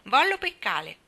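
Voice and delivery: put-on a small boy begging in baby talk; toddler baby talk. Toddler Teddy asks his mother to go fishing: eager baby talk. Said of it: "Teddy l'avesse fermata dicendo".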